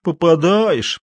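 high, drawn-out contemptuous repetition; sneering, falling echo; scornful echo. A narrator's reading. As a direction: mimic the question with disdain, stretching it. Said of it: "презрительно выговорил Матвей".

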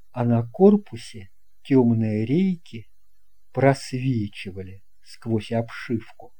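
usual speaking voice

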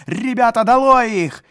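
very high, loud rallying yell; shouting. A narrator's reading. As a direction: scream to the mob. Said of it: "закричали дворовые".

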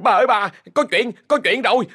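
high, panicked servant's voice; gasping out urgent news; breathless servant. A narrator's reading. Servant rushing to tell the mistress, breathless and panicked. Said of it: "Nó nói đứt quãng thông báo".

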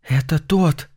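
breathless frightened half-whisper; hushed, scared, rising at the end; holding breath. A narrator's reading. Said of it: "затаив дыхание, прошептал Чарльз".

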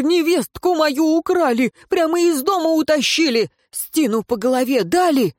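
a distraught father begging for help; frantic peasant. Acted. The peasant frantically begs the advisor to save his kin.

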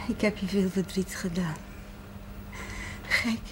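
ordinary delivery

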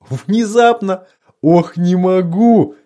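внезапно thrown back high, mocking; laughing incredulously. A narrator's reading. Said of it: "повалился на кровать, держась за живот".

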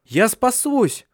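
high, very slow chant; like a spell. A narrator's reading. Handsome one repeats his hope like a spell, slow high. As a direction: repeat it like an incantation. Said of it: "как заклинание повторял".

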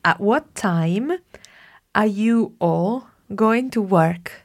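slow dictation. Shown as a demonstration. Teacher dictating sentence slowly. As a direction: read slowly for translation. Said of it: "second translation sentence".

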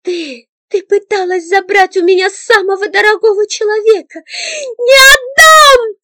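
very high, loud tearful scream; crying shout. A narrator's reading. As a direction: scream through tears, defending him. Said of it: "С криком замахнулась она".

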